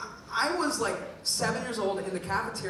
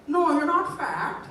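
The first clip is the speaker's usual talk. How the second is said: mom voice; 9 semitones higher than usual. Retold or imitated. Son asks mom about being called fat, she answers in a high mom voice.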